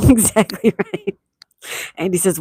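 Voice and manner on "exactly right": loud, slow words through laughter; laughing agreement. Real, not acted. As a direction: agree while cracking up, drawn out.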